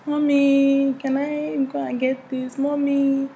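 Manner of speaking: put-on high little-kid Nigerian accent; childhood Nigerian accent. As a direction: imitate yourself as a small Nigerian child, high-pitched. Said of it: "I spoke like this".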